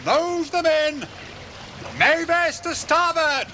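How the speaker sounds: slow, high shouted directions; drawn-out calls from shore; calling orders. Acted. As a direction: guide the boats in.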